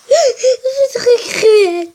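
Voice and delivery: gasping, slow, laughing mock outrage. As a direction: play shocked through a laugh. Réagit aux ennemis cruels. (indignation feinte en riant, voix aiguë et lente)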